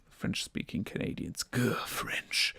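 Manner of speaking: hushed mock grudge, half joking; a sly whispered grumble at the French; mock grumbling whisper. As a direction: whisper it with a playful growl. Mock grudge against French Canadians.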